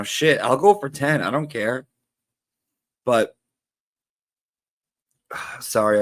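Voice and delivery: normal talking voice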